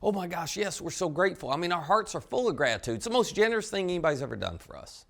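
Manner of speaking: high-pitched, gushing mock thanks; acted eager, effusive agreement; emphatic gratitude. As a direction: gush emphatically on behalf of the grateful group. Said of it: "they would have all emphatically said".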